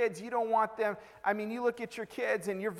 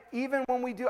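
ordinary talk, nothing marked

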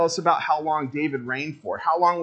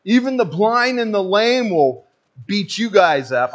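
mock trash talk; 4 semitones higher, 4 dB louder than usual. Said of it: "they're trash talking David".

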